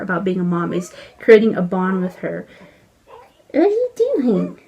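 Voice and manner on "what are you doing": high singsong talk to an infant. Real, not acted. Mom cooing at baby.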